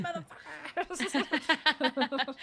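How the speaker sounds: slow, drawn-out taunt, laughing through it; defiant taunt. Retold or imitated. Teacher taunting the school system, slow laughing dare. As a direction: dare them to come after you, high and laughing. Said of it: "just do whatever you want and be like".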